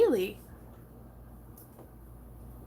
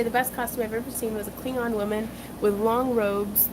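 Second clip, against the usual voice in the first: usual speaking voice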